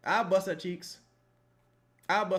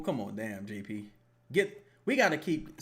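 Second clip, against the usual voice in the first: usual speaking voice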